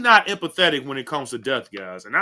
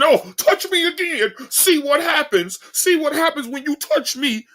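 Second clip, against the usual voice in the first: high, taunting imitation of a hothead daring someone; high hammed-up threat; mock tough guy; 10 semitones higher than usual. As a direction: mimic him squaring up, pitched way up. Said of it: "But if he doing".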